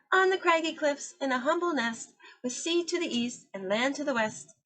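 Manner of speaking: a gull talking from her cliff nest; kind kelp gull. Acted.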